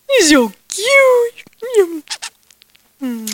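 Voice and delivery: gushing over the little lamb, high cooing. Real, not acted. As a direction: coo it over a cute lamb.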